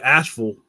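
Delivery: Asheville said as ASH-ful, firmly; local pronunciation. Shown as a demonstration. Showing viewers how you say Asheville, local pronunciation. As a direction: punch Asheville with a swallowed "-ful" ending. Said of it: "That's how you say it".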